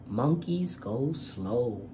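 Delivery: usual speaking voice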